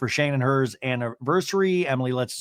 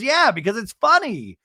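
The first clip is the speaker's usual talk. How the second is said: higher-pitched imitated comeback; acted retort, pitched up; unapologetic retort; 7 semitones higher than usual. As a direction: throw it back brightly in mock defence.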